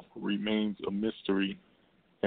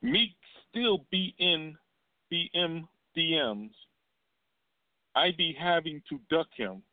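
imitating [name]; 7 semitones higher than usual. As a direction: drag the words out high, mocking her. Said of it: "and said".

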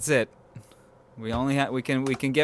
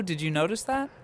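ordinary talk, nothing marked

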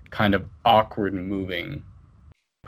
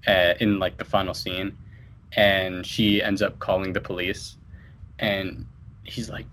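ordinary talk

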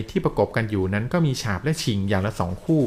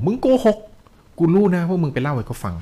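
higher-pitched, mildly angry retort; acted angry accusation; 7 semitones higher than usual. Ghost chief rejects the dreamer's excuse, acted angry accusation. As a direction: throw back the accusation, storyteller style.

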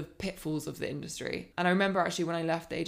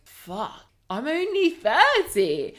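a sharp, high, loud burst of surprise; sudden realization; 10 semitones higher, 10 dB louder, slower than usual. Retold or imitated. Realizing at thirty she still has time, surprised and loud. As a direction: shout it like it only just hit you. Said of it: "I was like".

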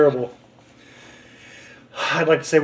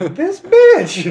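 mock outrage; 10 semitones higher than usual.

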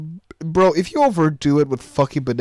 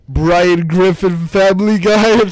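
mock announcement; 6 semitones higher, 7 dB louder, much slower than usual. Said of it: "That's your new name now".

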